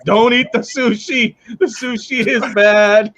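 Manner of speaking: laughing, slowed mock PA warning; laughing mock announcement. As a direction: parody the cafeteria warning while laughing. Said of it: "at the school cafeteria instead of Woodstock".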